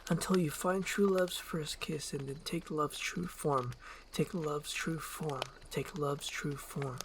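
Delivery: fading, hushed, slowing incantation; echoing spell. Acted. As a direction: let the magic echo away.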